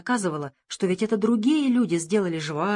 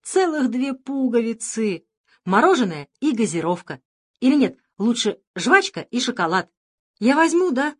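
excited; 4 semitones higher, 4 dB louder than usual. Acted. Boy excited about buttons.

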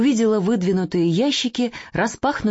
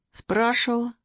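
reluctant; 5 semitones higher than usual. Acted. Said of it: "неохотно сказал".

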